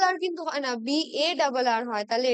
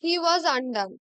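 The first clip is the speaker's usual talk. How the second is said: slow dictation; much slower than usual. Shown as a demonstration. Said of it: "মিনিংটাই change করে দিচ্ছে".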